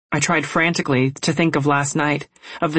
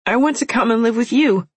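put-on pleading young girl; 8 semitones higher than usual. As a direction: beg your big sister desperately. Begging to come live.

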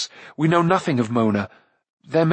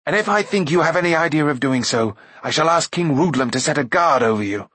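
stern threat, slightly raised; angry order; 3 semitones higher than usual. A narrator's reading. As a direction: threaten her with acted, contained anger. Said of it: "he ordered angrily".

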